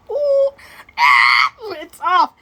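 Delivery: loud high squeal then shout; mock yelp. Reacting in bed, a loud high mock yelp. Exclaims loudly in the story.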